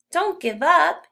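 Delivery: put-on boy urging a friend not to quit; encouraging call. A narrator's reading. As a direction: cheer him on to keep going. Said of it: "he tells Louis".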